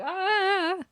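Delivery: high-pitched mock scream; long high "ah" in mock pain; imitated scream. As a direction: yelp like a cartoon victim.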